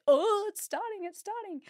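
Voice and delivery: high, eager squeal; excited. Retold or imitated. Expectant mother feeling twinges, high eager squeal. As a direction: gasp it out at every twinge. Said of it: "anything, I was like".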